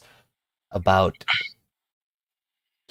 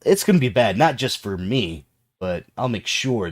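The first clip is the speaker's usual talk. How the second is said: angry threat; 3 semitones higher, 6 dB louder than usual. Acted. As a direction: push him away with heat. NPC threatens player character.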